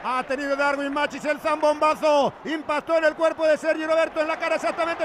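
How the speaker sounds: normal talking voice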